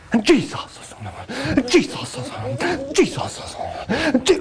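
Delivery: high, laughing cry of Jesus; hammed-up preacher voice; mock preacher. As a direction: parody a preacher calling Jesus.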